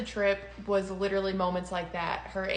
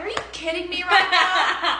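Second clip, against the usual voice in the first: laughing disbelief; 8 semitones higher, 6 dB louder than usual. Retold or imitated. Disbelief when friend refuses.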